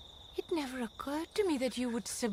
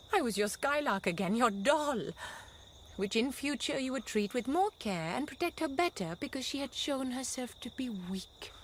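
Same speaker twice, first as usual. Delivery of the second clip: ordinary delivery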